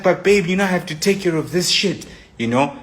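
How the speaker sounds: brushing her off in a hurry; defensive protest. Retold or imitated.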